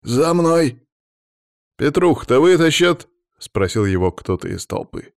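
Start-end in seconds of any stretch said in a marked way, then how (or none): 0.0-0.9 high, loud command; rallying shout; calling out. A narrator's reading. Chief calling his crew to follow. 1.8-3.1 high, loud worried question; anxious raised call; calling from the crowd. A narrator's reading.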